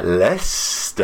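slow "Leicester", LES-ter, savoured; mock posh teaching "Leicester"; rolled Leicester. Shown as a demonstration. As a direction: let "Leicester" roll off the tongue slowly. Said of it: "Say it with me now".

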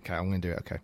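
low, hushed grumble to himself; dreading a call. Pretend. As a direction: play-act reluctance to pick up, under your breath. Dreading his father-in-law's call.

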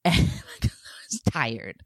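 choked, tearful near-whisper, slow; emotional. Real, not acted. Mom confessing she lost patience with her son, choked up. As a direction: let real tears catch the voice. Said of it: "it makes me really emotional".

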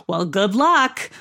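"Well, good luck" drawn out slowly with an odd lilt; weird doubtful inflection. Retold or imitated. Customer wishing the waitress luck, "good luck" slow with doubtful inflection. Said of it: "That weird inflection on the end".

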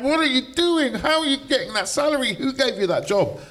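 high-pitched incredulous challenge; indignant confrontation. Retold or imitated. Arguing in the face of BBC bosses, indignant.

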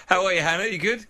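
usual speaking voice

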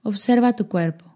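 normal talking voice

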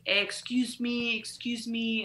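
mock French guy calling. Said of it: "a French guy started to be like".

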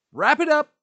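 high, loud "Wrap it up"; a sudden exasperated yell; fed-up shout. Retold or imitated. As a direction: blast it out, done with everything. Imagined giving up on world.

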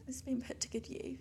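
sly whispered aside. Pretend. Boasting about the gifted bra, whispered slyly. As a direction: whisper it like a cheeky secret.

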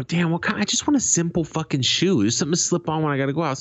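high, exasperated plea. Retold or imitated. Shopper wants a simple shoe, exasperated. Fed-up shopper quoting himself.

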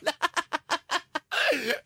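high, drawn-out laughing; real laughter. Real, not acted. Still enjoying friend's tie joke, laughing.

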